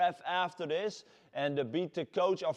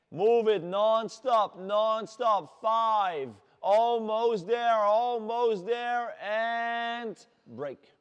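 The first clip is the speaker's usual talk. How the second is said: loud, drawn-out coach call, higher; coach shout; 5 semitones higher, 6 dB louder, much slower than usual. Real, not acted. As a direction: hold them through the last seconds, loud and slow. Coach pushes final seconds.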